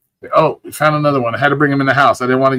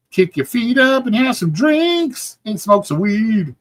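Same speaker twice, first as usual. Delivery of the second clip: high, slow, goofy put-on voice; mock stoner advice, pitched up; laid-back voice; 10 semitones higher, slower than usual. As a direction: drawl it out, playful and high. Chill advice to Denise.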